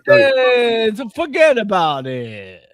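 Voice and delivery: loud, high New York Italian "forget about it"; slow, hammed-up Italian American accent; Italian American accent, drawn out and loud; mock Italian.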